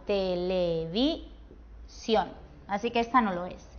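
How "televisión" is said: "televisión" slowly syllable by syllable, landing on SIÓN; stress on last syllable. Shown as a demonstration. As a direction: break up "televisión" to show the final stressed SIÓN. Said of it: "la sílaba tónica es la última". ("televisión" lento, por sílabas, con acento en SIÓN)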